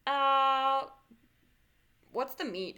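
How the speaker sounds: ordinary talk, nothing marked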